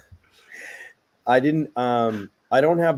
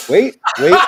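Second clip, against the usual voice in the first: laughing interruption; 12 semitones higher, 8 dB louder than usual. Real, not acted. Laughing, stopping his own story.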